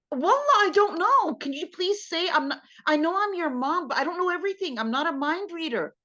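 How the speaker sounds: high-pitched, pleading protest; exasperated mom. Acted. Acted exasperated mom answering her child.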